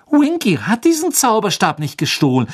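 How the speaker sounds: put-on high, shrill girl's protest. A narrator's reading. Hermine defends Winky against the accusation, shrill. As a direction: protest shrilly, pitched right up. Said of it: "sagte Hermine schrill".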